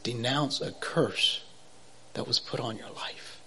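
slow, hushed whisper; quiet voice. Retold or imitated. The voice repeating its command, a slow whisper. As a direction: breathe the words out slowly and softly. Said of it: "quiet voice".

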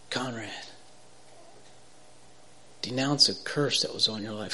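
hushed, breathy whisper of a voice heard in bed; quiet voice. Retold or imitated. God's voice to him in bed, a soft whisper. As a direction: whisper it like a voice from nowhere. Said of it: "quiet voice".

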